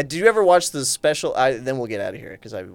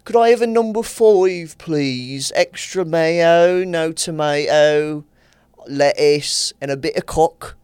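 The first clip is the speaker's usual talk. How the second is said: put-on drawn-out Midlands accent, higher and louder; gross British accent; 3 semitones higher, 4 dB louder, much slower than usual. Mimicking his family from the Midlands ordering, exaggerated British accent. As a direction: order food in broad, slow Midlands British. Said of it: "My family has a gross British accent".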